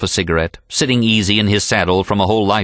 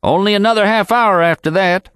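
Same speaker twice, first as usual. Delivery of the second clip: higher cowboy voice, easygoing; old mountain guide; 12 semitones higher than usual. A narrator's reading. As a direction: chat from the saddle like a lifelong horseman. Happy voiced in the story.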